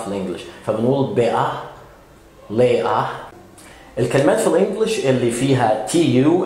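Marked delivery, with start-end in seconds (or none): 1.1-1.5 بقى as better with a catch for the T, "beh-uh"; British better with T swallowed in بقى; glottal stop. Shown as a demonstration. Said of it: "glottal stop". 2.5-3.1 لقى as later with a catch for the T, "lay-uh"; British later with T swallowed in لقى; glottal stop. Shown as a demonstration. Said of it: "glottal stop".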